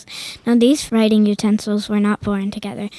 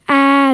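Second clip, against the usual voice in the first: loud group-style "Ah!" scream; child's storybook scream; 4 semitones higher, 8 dB louder than usual. A narrator's reading. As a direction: shriek in fright, louder. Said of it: "they screamed".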